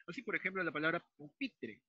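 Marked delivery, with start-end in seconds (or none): 1.2-1.8 "pupitre" with the stress on PI; stressed syllable. Shown as a demonstration. Teacher showing the tonic syllable, "pupitre" stressed pu-PI-tre. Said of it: "esta sílaba suena más fuerte".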